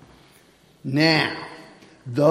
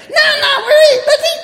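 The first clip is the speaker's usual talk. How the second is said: much higher, much louder squawky chicken voice with laughter; hungry chickens; 9 dB louder, faster than usual. Acted. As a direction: laugh through a clucking demand for food. Chickens refuse going back.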